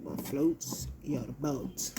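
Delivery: slow, musing repeat. Real, not acted. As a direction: stretch out "What floats your boat?" slowly. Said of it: "That's how I see it".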